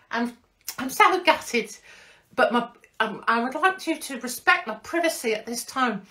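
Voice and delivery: high put-on voice of a jilted influencer; mocking Molly voice. Impersonating the dumped girlfriend's statement, high and hammed-up. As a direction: whine it high and theatrical. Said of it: "Is this how she speaks?".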